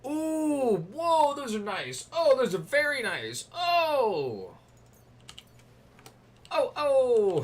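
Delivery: high, loud gasps of delight; thrilled. Real, not acted. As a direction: ooh and ahh over shiny treasure. Thrilled at nice loot.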